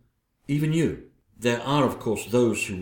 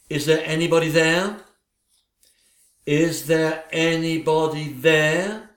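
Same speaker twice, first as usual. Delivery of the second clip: seance call; 4 semitones higher, 3 dB louder than usual. Acted. Summoning a spirit.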